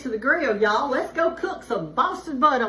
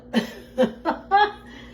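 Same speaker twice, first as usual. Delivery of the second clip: short breathy giggle; a little laugh at her own joke; 6 semitones higher than usual. Real, not acted. Cook laughs at her butt-rubbing joke, breathy giggle.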